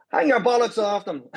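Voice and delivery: high-pitched, gleeful retort; retold punchline. Pacer springs old Dublin joke on runner, teasing punchline.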